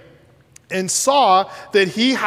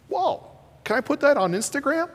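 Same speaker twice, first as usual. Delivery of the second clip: mock amazement; 7 semitones higher, 4 dB quieter, faster than usual. Imagined crowd reacting to miracle.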